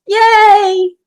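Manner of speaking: high, bright little yay of delight; thrilled cheer at a compliment. Real, not acted. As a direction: squeal it out happily.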